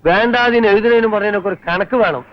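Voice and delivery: heated. Acted. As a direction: defend the teacher hotly. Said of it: "അതിന് താനെന്തിനാ ഇങ്ങനെ ചൂടാവണത്?". (ചൂടായി ശകാരിച്ചുകൊണ്ട്)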